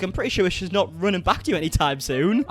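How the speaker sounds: normal talking voice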